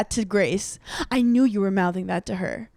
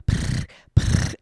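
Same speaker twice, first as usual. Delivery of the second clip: unvoiced pfft hiss like a cat; mock cat spit, pfft pfft; cat spitting sound. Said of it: "I was going".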